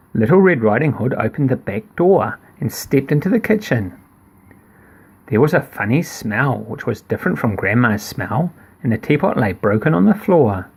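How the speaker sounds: ordinary delivery, nothing marked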